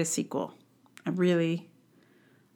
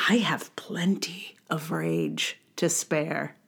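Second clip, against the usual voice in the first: whisper; 4 semitones lower, 7 dB quieter, slower than usual. Acted. Dramatic low whisper about rage.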